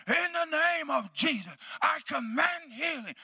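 high, drawn-out healing command; re-enacted authoritative prayer. Preacher praying with the authority of Jesus, commanding prayer. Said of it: "praying out of my heart with the authority".

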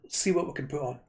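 burping. Real, not acted. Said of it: "I can't stop burping now".

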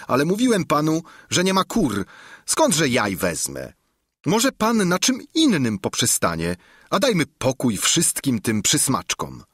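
the innkeeper losing patience. A narrator's reading.

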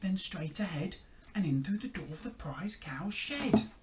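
tiny, high, hushed bug voice; high, half-whispered ladybird telling the secret plan; little ladybird. A narrator's reading. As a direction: pipe up like a very small creature. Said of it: "the little spotty ladybird".

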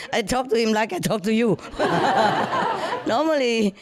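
ordinary delivery

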